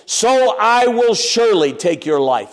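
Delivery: boastful giant. Retold or imitated. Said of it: "Maybe he said something like".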